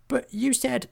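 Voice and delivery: high-pitched timid objection; protesting goblin. Acted. Hobgob starts to object to the sergeant, high-pitched protest.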